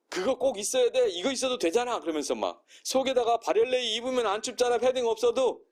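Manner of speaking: 높은 톤으로 울먹이며 화내는 흉내; high-pitched, tearful scolding, acted; mock angry mother. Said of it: "막 울면서 화내면서". (울면서 화내는 엄마 흉내, 높은 톤)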